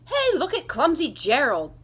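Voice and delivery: loud mocking jeer; a crowd of animals jeering; sneering. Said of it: "the animals all sneered".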